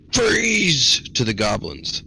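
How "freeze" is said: high sharp yell of Freeze; shouted spell word. Acted. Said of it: "as he says".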